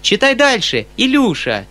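put-on eager high-pitched girl's call; girl crying out. A narrator's reading. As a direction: urge little brother on with a cry. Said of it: "вскричала Сашенька".

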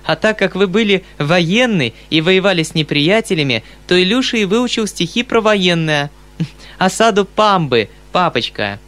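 put-on lively girl's voice; amused daughter. A narrator's reading. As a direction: explain it with mischievous eagerness.

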